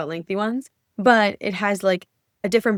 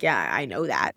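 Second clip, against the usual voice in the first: lower, curt, mock-snotty retort; mock rude reply; 8 semitones lower than usual. Copywriter imitating a rude reply to a client, lower and curt. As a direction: play the snarky answer she'd never give. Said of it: "obviously I'm not gonna be an asshole and be like".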